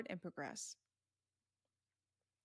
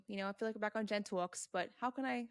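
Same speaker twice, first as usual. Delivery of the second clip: ordinary talk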